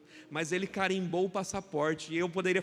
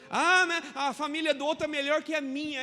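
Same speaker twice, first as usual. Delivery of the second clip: higher, louder whiny mock; mock complaining believer; 6 semitones higher, 6 dB louder than usual. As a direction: poke fun at the grumbler. (imitação de fiel reclamão, mais aguda)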